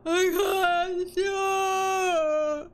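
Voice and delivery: said through a long yawn. Real, not acted. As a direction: stretch the words out mid-yawn. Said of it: "why do I keep yawning".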